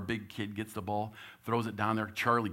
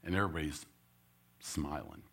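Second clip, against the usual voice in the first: ordinary delivery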